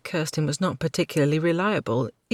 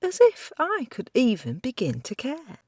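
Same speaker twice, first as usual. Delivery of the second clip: higher, mocking grudging apology; a lightly voiced cheeky girl sneering through it; sarcastic young woman; 9 semitones higher than usual. Kirsten voice.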